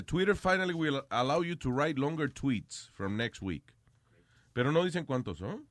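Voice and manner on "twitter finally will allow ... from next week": reading the headline. Shown as a demonstration.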